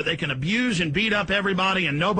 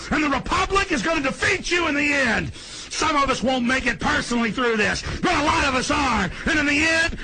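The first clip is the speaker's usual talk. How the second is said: raised, heated preaching tone; angry defiance; 7 semitones higher than usual. Real, not acted. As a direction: threaten them with righteous anger. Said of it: "I'm pissed off now".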